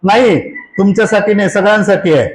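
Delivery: usual speaking voice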